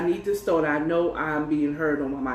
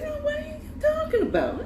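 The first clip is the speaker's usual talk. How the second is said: very high, quick mocking voice; mock denial; 3 dB quieter, faster than usual. Man denies hidden backup to her, mocked in high voice. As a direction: squeal a fake innocent protest.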